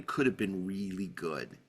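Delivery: usual speaking voice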